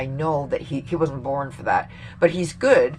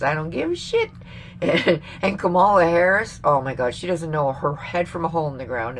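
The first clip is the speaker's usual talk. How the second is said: ordinary talk, nothing marked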